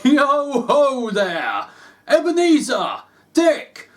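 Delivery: put-on a merry old master calling his lads; jovial old man. A narrator's reading. Fezziwig calls his apprentices in a rich jovial voice. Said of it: "in a comfortable, rich, jovial voice".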